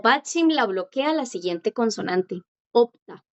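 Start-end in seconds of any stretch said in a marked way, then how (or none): 2.7-3.2 Opta with no S sound before the t; silent S in Opta. Shown as a demonstration. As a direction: say Opta, the consonant after blocking the S. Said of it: "aquí la S no suena".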